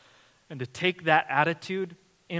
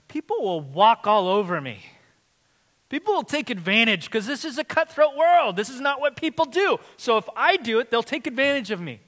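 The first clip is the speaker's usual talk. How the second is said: higher, louder protesting voice; imitating a worried objector; 9 semitones higher, 9 dB louder than usual. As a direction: push back like an anxious skeptic. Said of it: "some of you might say".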